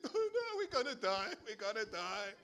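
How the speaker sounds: high, laughing mock panic; mock complaining. Israelites seeing the Egyptians, in laughing mock panic. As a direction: whine it in hammed-up panic. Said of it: "They complain again".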